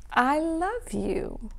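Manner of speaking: slow, soft and tender; tender love. Acted. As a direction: whisper it lovingly to a child. Tender goodbye to the children.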